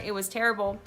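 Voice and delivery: usual speaking voice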